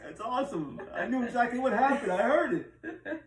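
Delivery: high voice breaking with laughs; real laughter. Real, not acted. Host recalls hearing the nose burp, laughing hard. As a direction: keep talking while helpless with laughter.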